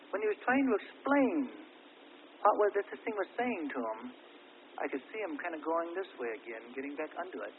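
usual speaking voice

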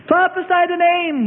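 high-pitched mocking taunt. A narrator's reading. Guard mocking a blindfolded Jesus, taunting. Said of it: "one taunted".

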